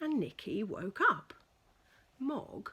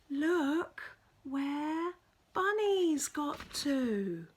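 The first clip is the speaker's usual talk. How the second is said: delighted surprise; 7 semitones higher, 3 dB louder, slower than usual. Acted. Debbie's delighted discovery.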